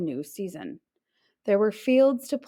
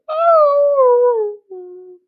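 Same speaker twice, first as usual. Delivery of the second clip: long, much higher and louder yelping "Oh"; puppy yelp; 10 dB louder than usual. Acted. Puppy raising his head to the sky, yelping loudly. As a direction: let out a big puppy howl. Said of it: "yelped out another".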